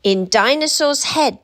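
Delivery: plain reading voice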